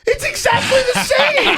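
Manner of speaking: very high incredulous yell; high screeching mock outrage; exasperated outburst. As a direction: explode in comic disbelief, pitched sky-high. Exploding at "That's different.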